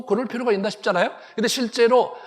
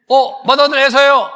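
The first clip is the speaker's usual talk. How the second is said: loud, higher show-off calling out; boastful eager Esau; 4 semitones higher, 8 dB louder than usual. Retold or imitated. Preacher voicing Esau to Isaac, a loud boastful call. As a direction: Esau proudly announcing himself to his father, loud and eager.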